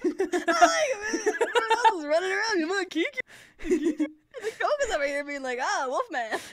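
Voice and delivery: high, loud giggling rant; laughing mock rant. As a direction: rant while cracking up. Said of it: "I like".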